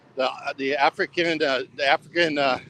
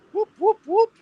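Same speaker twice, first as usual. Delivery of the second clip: high whooped siren imitation; 4 dB louder than usual. Walking at night mimicking a siren, high whoops.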